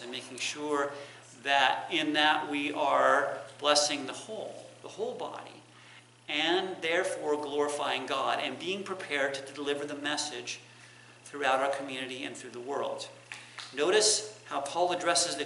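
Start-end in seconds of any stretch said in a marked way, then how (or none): none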